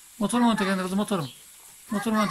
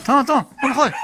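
shouting stop; 5 semitones higher, 8 dB louder than usual. Real, not acted. Father shouting to stop water.

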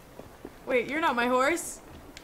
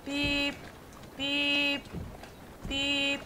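mock horn beep; 8 dB quieter, much slower than usual. Imitating a horn.

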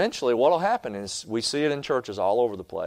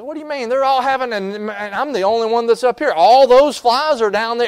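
high, eager fly insisting, getting louder; fly protesting; 8 semitones higher, 7 dB louder than usual. Retold or imitated. Fly protesting to the bee, high and eager. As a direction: whine it higher and push louder. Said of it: "He says".

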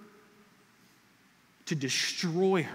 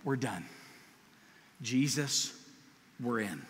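softer, lower firm commitment; quiet resolve; 6 semitones lower, 8 dB quieter than usual. Retold or imitated. Disciples telling Jesus they're in, quietly resolved. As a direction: say it like a decided vow. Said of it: "came back and said".

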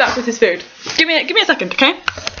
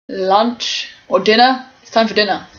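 normal talking voice

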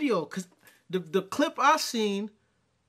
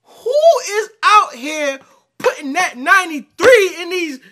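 incredulous shout; 9 semitones higher, 14 dB louder than usual. Real, not acted. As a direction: blast it out in total disbelief. Reacting in disbelief to a dating clip.

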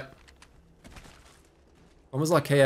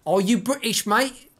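higher, teasing imitation of Americans faking British; mock British accent; 7 semitones higher, slower than usual. Retelling how Americans taunt a streamer, mock British accent. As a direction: imitate a badly faked British accent, rising at the end. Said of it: "all the Americans go".

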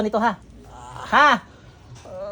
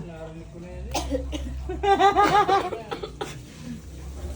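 long burst of laughing; laughter; 4 semitones higher, 4 dB quieter than usual. Real, not acted. Healer joking with a patient before treatment, laughing. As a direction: laugh it out at a joke.

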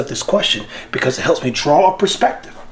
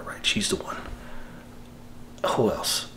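hushed and unvoiced; whisper; 4 semitones lower, 14 dB quieter than usual. Real, not acted. Breeder confiding his pick, whispered. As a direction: whisper it like a secret.

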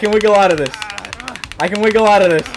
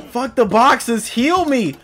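normal talking voice

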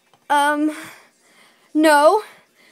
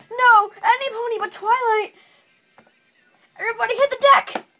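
panicked cry; 6 semitones higher, faster than usual. Acted. Pony dreading Twilight's arrival, panicked cry. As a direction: beg in alarm.

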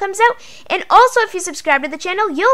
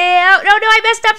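sudden higher, louder cry of dismay at a slip; real oops; 6 semitones higher, 6 dB louder than usual. Real, not acted. Building on camera, catches her own mistake, higher louder dismay. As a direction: blurt it out as the build goes wrong. Mistake while counting blocks.

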